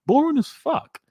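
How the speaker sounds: a jokey high-pitched dismissal; mock squeaky verdict. Real, not acted. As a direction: squeak it out like a bored brat. Scathing verdict on the show.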